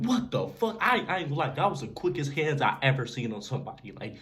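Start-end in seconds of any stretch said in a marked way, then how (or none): 0.0-0.8 shocked. Retold or imitated. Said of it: "I'm like".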